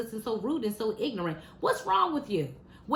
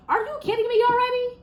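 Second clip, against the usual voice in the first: much higher, louder cry of disbelief; outraged disbelief; 12 semitones higher, 5 dB louder than usual. Real, not acted. Telling viewers about guns at a kids' movie, high loud outraged disbelief. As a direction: shriek it like you can't believe your ears.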